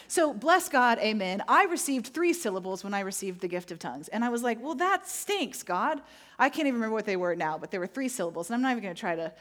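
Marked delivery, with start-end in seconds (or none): 4.6-6.0 high, drawn-out complaint; mock disappointment. Retelling a letdown to God, mock disappointed.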